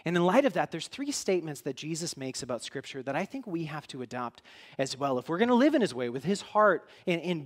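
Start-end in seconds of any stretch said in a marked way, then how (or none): none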